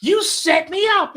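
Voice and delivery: loud, high mock indignation; retold outraged accusation. Storyteller accusing [name] after the bus ejection, indignant. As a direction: hurl the accusation, voice jumping up.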